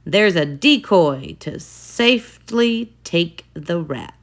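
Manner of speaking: usual speaking voice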